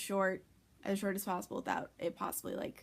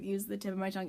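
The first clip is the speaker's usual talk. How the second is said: ordinary talk, nothing marked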